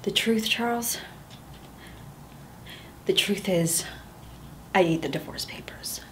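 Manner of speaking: hushed, unvoiced confession; whispered confession. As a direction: breathe it out as a secret nobody else may hear.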